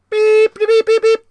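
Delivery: very high, loud beeping; beeping noise. Retold or imitated. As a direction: squeal beep beep like a machine. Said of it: "she's all like".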